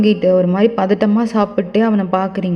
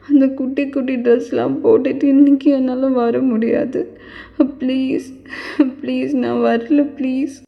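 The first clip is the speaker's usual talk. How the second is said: tearful sleep pleading. Acted. Sleeping woman refusing to be taken there, acted tearful begging. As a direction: plead helplessly, acting close to tears. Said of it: "இப்ப எதுக்கு இப்படி அழுகுற தூக்கத்துல".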